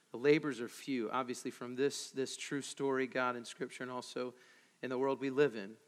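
hushed earnest prayer. Acted. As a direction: pray quietly over the congregation. Pastor prays.

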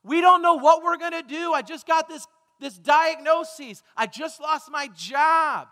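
shouting out. Acted. People in trouble crying out to the church, shouting. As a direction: call out your troubles with force. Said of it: "Who are still shouting out".

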